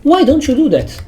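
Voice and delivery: disbelieving plea to the parents; exasperated question. Real, not acted. Venting at parents, high and exasperated.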